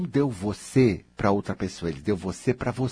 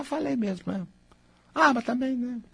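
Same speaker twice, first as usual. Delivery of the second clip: carefree shrug; 13 semitones higher than usual. Pretend. A coach playing a self that shrugs off a blunder, breezy. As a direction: shrug it off lightly.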